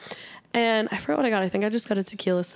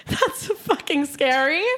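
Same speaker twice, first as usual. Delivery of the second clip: laughing; 7 semitones higher, 3 dB louder, slower than usual. Real, not acted.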